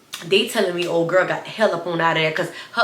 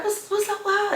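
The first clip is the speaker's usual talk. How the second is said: much higher, baffled question; high puzzled; 14 semitones higher, 3 dB quieter than usual. Retold or imitated. Classmates staring at the girl, puzzled and high. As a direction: ask it in disbelief.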